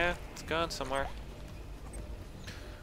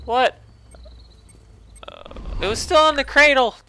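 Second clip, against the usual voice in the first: high, loud startled protest; shocked outburst; 13 semitones higher, 12 dB louder than usual. Real, not acted. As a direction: yelp it out in disbelief. Sudden explosion while holding item.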